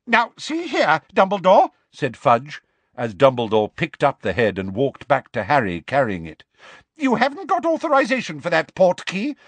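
high, huffy protest; indignant Fudge. Acted. Minister scolds Dumbledore over the portkey, high and indignant. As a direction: object like an offended official.

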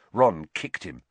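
ordinary delivery

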